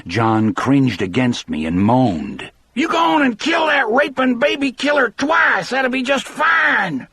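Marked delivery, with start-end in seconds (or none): 2.8-7.1 put-on a shrieking, much higher scream from the old mother. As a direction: howl it at the guards from the crowd. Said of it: "Her ragged, strident voice was like a slap".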